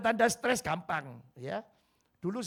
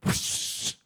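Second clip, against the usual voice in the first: whooshing wave sound. Shown as a demonstration. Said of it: "imitating wave".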